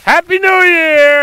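slow, loud, high festive holler; cheerful shout. Real, not acted. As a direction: call it out celebrating. Cheering after the plunge.

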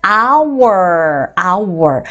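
"hour" slow and drawn out, starting on "ah"; "hour" modelled as "ah-wer", H silent. Shown as a demonstration. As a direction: stretch "hour" with no H at the front. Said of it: "hour 해서 H 사운드가 묵음으로".

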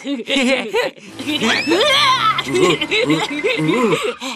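loud laugh. Acted. Cartoon friends chatting, laughing.

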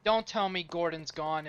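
ordinary talk, nothing marked

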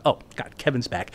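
hushed dread under the breath; low worried murmur; quiet panic. Retold or imitated. Panicked thought at knock.

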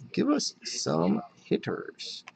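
soft, slow, word-by-word plea; slow pleading repeat. Real, not acted. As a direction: draw out each word of the plea. Repeats the plea slowly, spaced out.

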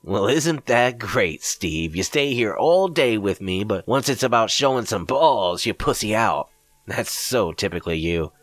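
mocking taunt. A narrator's reading. Greg taunting the narrator.

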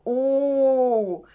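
long, steady held "у"; sound demonstration. Shown as a demonstration. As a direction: sustain the sound for pupils. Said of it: "звук". (протяжний звук «у»)

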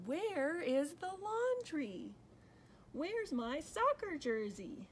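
put-on high, wailing child's questions; gasped dismay. A narrator's reading. Danny asks Mom for the lost laundry, dismayed wail. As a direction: plead in acted alarm, pitched well up. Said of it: "gasped Danny".

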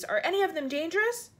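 high, worried call; shouted question. A narrator's reading. Police chief wary of the creatures, mildly shouted. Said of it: "shouted the chief of police".

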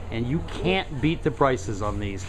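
ordinary talk, nothing marked

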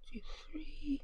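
soft whisper, counting items to herself. Real, not acted. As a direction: count under your breath. Counting under breath.

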